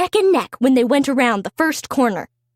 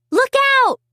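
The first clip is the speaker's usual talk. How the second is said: alarmed cry; 11 semitones higher than usual. Acted. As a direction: yell it to stop the train in time. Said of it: "cried Molly".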